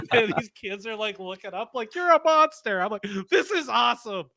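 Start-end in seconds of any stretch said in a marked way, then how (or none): 1.9-2.8 high-pitched mock outcry; horrified teen. Teens turning on the yelling fan, mock horror. 3.3-4.3 high-pitched imitation of excited cheering; mock-holler it back with delight; retold fan glee. Fan answering horrified teens, mock excitement.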